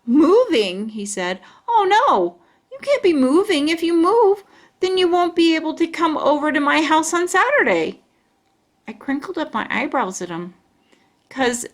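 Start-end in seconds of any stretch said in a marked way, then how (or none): 0.0-0.9 upset. A narrator's reading. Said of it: "Grandpa Miller sounded upset". 1.7-8.0 grandpa's hammed-up dismay, higher and louder; mock upset. A narrator's reading. Grandpa on the phone, in pretend upset. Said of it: "Grandpa Miller sounded upset".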